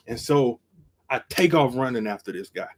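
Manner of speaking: usual speaking voice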